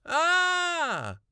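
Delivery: high, very loud scream; angry scream. A narrator's reading. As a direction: scream in sheer frustration. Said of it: "I let out an angry scream".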